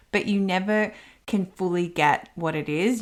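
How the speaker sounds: normal talking voice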